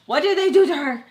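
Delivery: loud, high, exasperated demand; alarmed outburst. Retold or imitated. Worrying over her unanswering friend, alarmed outburst. Inner outburst about missing Sam.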